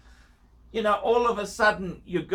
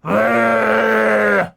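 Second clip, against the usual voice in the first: loud laugh; 9 dB louder than usual. Real, not acted. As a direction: burst out laughing at the very idea. Laughing at Datejust.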